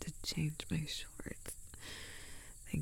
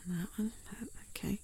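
ordinary delivery

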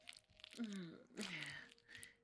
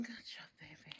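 soft whispered praise; whispering; 3 semitones lower, 7 dB quieter than usual. Real, not acted. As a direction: whisper it tenderly and low. Tender praise after climax.

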